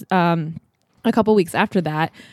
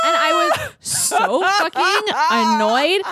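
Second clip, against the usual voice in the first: high, slow, indignant shout; slow, high, outraged yell; mock outrage; 10 semitones higher, much slower than usual. Real, not acted. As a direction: drag it out in outrage. Annoyed shower story.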